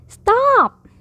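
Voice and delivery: high-pitched shouted command; shouting. A narrator's reading. Child halting Bear's bubbles in the bathroom, shouting. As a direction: call it out high, falling at the end. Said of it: "I shouted".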